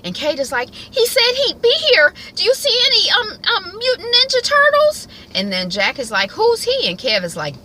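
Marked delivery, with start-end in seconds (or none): 0.7-5.1 put-on very high, loud, childlike squeal; little-girl Kate voice. Voicing young Kate. 6.3-6.9 squeaky, loud question; high mock Jack. Voicing Jack.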